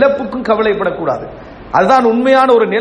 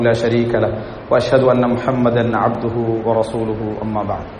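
slow, low, level recitation; Arabic khutbah opening; 8 semitones lower, slower than usual. Acted. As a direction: intone the sermon's opening praise slowly and low. Recites Arabic opening sermon formula.

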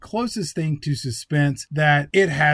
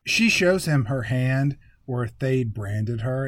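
usual speaking voice

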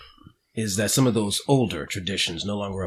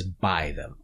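disgusted; 7 semitones lower, 7 dB quieter than usual. Acted. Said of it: "You hear a note of disgust when he says".

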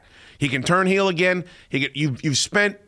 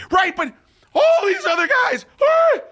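high, louder rising shout; frustrated yell; 4 dB louder than usual. Retold or imitated. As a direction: burst out in frustration. Frustrated yelling at wife.

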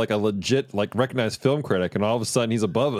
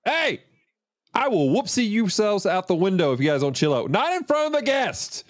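yelling; 9 semitones higher than usual. Real, not acted. Breaking up the fight.

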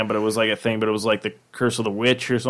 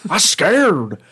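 Charles Barkley impression; 5 semitones higher than usual. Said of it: "that was a terrible".

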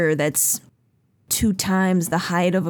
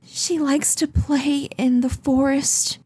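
high, pleading mother's voice, near tears; distraught mother; 8 semitones higher, slower than usual. Acted. Frantic mother begs the Seekers to find her daughter, high and pleading. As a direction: beg like a frantic mother whose child is gone. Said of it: "and she says".

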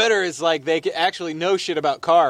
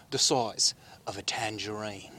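low, quiet, slow British accent; Michael Caine; 8 semitones lower, 9 dB quieter than usual. Shown as a demonstration. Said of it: "get into the Michael Caine accent".

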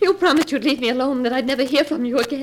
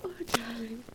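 very soft low longing; despairing sigh; 3 semitones lower, 14 dB quieter than usual. Acted. Wife alone after husband leaves, soft despairing sigh. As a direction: breathe it out in despair.

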